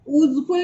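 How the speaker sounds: ऊजफुल starting on oo, no y sound; ऊजफुल without the y glide; vowel U start. Shown as a demonstration. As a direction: misread useful as ऊजफुल. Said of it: "नहीं हुआ".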